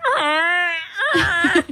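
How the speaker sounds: cracking high croak; creaky laugh sound. Retold or imitated. Trying a baseball impression, cracking croak. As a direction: let the voice crack like a creaky door. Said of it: "I just went".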